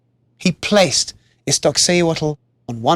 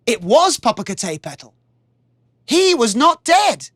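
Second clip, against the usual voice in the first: plain reading voice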